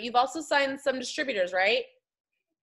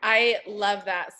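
usual speaking voice

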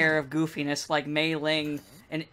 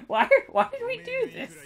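high, whiny exasperated squeal; mock whiny developer. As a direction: whine it in mock despair. Mocking the dev team's regret.

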